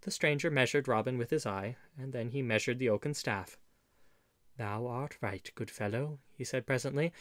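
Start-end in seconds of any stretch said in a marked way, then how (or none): 4.6-6.2 soft, calm concession; gentle agreement. A narrator's reading. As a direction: agree mildly and quietly. Gentle stranger calmly concedes to Robin.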